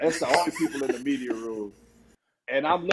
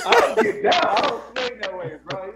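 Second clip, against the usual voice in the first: laughing denial; 6 semitones higher, 6 dB louder than usual. Real, not acted. Laughing denial of dating teammates.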